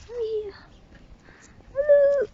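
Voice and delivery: high coaxing call; bright call to a horse; high pet call. Real, not acted. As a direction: coo the horse over. Calling the horse.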